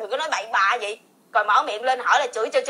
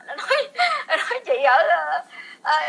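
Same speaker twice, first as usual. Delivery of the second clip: laughing mid sentence; 3 semitones higher than usual. Real, not acted.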